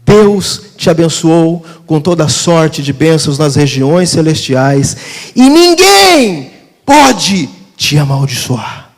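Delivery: slow, booming proclamation of blessing; full-force preaching. Real, not acted. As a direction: stretch out key words and thunder them.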